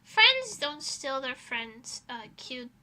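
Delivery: ordinary delivery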